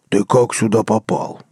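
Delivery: slow, otherworldly rumble; a monstrous head booming down from its booth; slow bass of a hellish guard dog; deep dog-head bass. A narrator's reading. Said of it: "пробасила третья голова".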